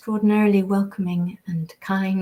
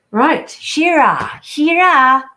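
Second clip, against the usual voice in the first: calling out; 7 semitones higher, 9 dB louder, much slower than usual. Real, not acted. Calling a viewer by name.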